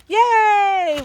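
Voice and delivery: high, bright squeal of delight; loud happy yay; excited cheer. Real, not acted. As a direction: cheer like you just found treasure. Lifting the Tyvek to reveal wrap.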